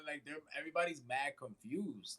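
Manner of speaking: ordinary talk, nothing marked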